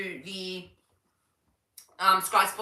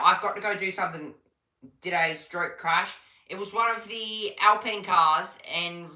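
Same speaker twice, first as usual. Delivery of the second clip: ordinary delivery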